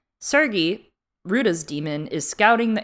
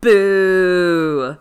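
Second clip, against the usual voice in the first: loud jeering boo; 2 semitones higher, 11 dB louder than usual. Real, not acted. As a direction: boo them loudly. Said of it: "This is my really well put together criticism".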